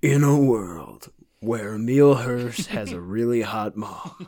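slow, dramatic trailer announcer; movie trailer voice. Pretend. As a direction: announce it like an epic blockbuster trailer. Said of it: "Do your best movie trailer voice".

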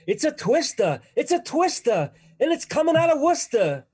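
high, loud Boston cry of alarm; Boston accent. Acted. Wizard of Oz character warns of a twister, loud Boston accent. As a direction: holler it in broad Boston, dropping the R's. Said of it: "Bostonian accent".

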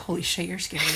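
awed hushed. Acted. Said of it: "Jude just like".

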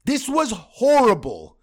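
slow, loud, drawn-out outburst; disgusted yell. Real, not acted. Fan host yelling at his team, slow and loud. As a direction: spit each word out in disgust.

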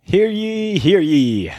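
booming town crier call; grand rich voice. Pretend. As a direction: proclaim it like a royal herald. Mock royal proclamation of release.

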